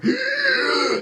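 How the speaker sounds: high acted gasp; long, desperate mock inhale; big gasp for air. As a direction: gasp as if surfacing from underwater. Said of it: "I take a deep breath of air".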